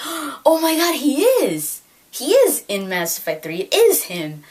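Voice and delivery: high and loud, thrilled disbelief; retold excited outburst. Vlogger telling viewers about spotting Sean Bean, excited. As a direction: relive the moment you found proof.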